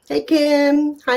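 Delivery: normal talking voice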